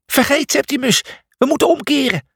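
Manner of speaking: very high panicked cry; high-pitched alarm; shrieking. A narrator's reading. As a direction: shriek to turn back. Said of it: "gilde Cornelius".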